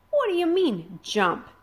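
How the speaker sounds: high, loud panicked protest. Acted. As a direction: protest in alarm. Hiccup panics at the drop.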